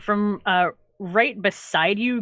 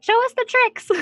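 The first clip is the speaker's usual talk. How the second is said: put-on much higher, louder, eager child; little girl voice; 5 dB louder than usual. Little girl begging the cleric for a trick, high and eager. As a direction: squeal it like an excited gap-toothed girl. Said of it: "She's like".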